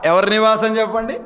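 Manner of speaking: usual speaking voice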